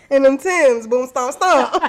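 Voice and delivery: laughing, loud and hyped; excited laughing shout. Retold or imitated. Acting out the stomping dance.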